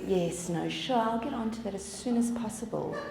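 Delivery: softly compliant work voice; managed smile. Acted. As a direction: put on a polite smile for a client. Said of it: "we'll manage a smile".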